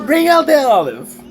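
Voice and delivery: high, loud mimicked yell; hammed-up shout; mock announcer shout. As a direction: bellow it like a dramatic TV reveal. Mimicking the shout from played clip.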